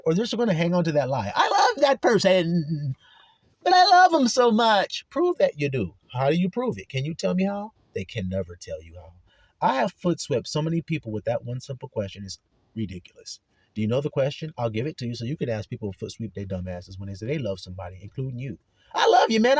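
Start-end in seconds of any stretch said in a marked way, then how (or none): 1.3-5.0 hammed-up pleading lover, slow then higher and louder; mock someone clinging to a lie. 18.9-19.6 hammed-up gushing "love", higher and louder with a rise; mock a friend overdoing affection.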